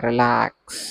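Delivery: Relax stretched out and fading; soothing relax cue. Real, not acted. Yoga teacher telling listeners to relax their toes, drawn-out soothing cue. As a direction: let relax trail away like a long exhale.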